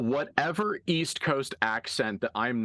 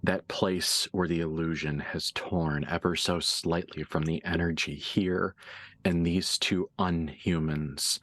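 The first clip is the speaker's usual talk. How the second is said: game master narration; 3 semitones lower than usual. Acted. GM describes two unhumans recognising each other, low suspenseful narration. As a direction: let each image hang, low and measured. GM's hushed scene setting.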